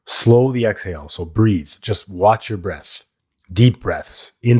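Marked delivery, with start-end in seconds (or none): none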